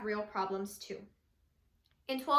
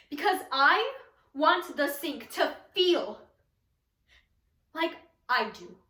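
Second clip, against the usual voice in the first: dramatic monologue; slower than usual. Acted. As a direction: recite it slowly with heavy pauses.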